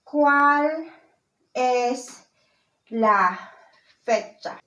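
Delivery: very slow, long pauses between words. Shown as a demonstration. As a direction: dictate it to a beginner, one word at a time. Teacher models new question slowly.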